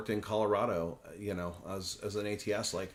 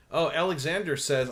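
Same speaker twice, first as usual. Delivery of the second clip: ordinary talk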